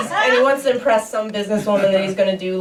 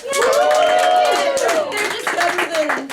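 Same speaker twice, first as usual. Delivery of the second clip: high squealed yay; sudden high-pitched mock cheer; much slower than usual. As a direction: cheer like an excited kid. Mimicking cheering cartoon fan.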